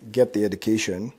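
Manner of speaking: usual speaking voice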